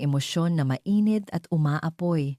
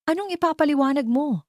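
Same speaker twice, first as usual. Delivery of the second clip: angry retort; 11 semitones higher than usual. Acted. Furious niece confronts aunt.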